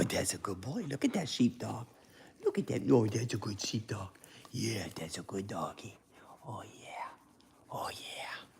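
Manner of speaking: hushed, cooing praise for the dog; soft dog talk. Real, not acted. Talking softly to his dog.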